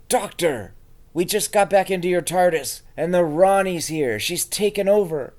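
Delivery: loud, high pretend alarm; alarmed shout. User warning the Doctor the Ronnie has taken over, alarmed shout. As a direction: raise the alarm, play-acting urgency.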